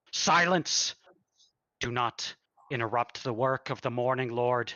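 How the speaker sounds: a zealot silencing a servant; furious abbot snapping a command; angry command. Acted. Abbot cuts off interruption.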